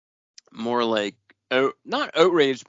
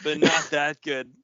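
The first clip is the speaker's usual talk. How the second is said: higher-pitched dramatic comic-book reading; mock melodrama; 8 semitones higher than usual. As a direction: read it out as hammed-up, anguished dialogue. Reads comic dialogue aloud in mock drama.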